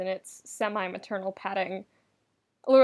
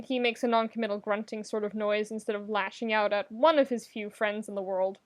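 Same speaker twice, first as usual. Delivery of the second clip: ordinary delivery, nothing marked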